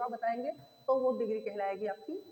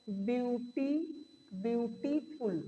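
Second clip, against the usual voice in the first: Beauty then beautiful, slow and split into beats; broken into syllables; much slower than usual. Shown as a demonstration. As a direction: say it slowly in two parts. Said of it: "दो बार में हम पढ़ते हैं".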